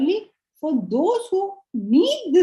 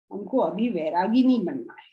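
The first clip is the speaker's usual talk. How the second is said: rattled off fast in Hindi; hurried refusal; 6 semitones lower, much faster than usual. Retold or imitated. Teacher imitating students refusing renunciation, rapid. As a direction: gabble it out like an excuse.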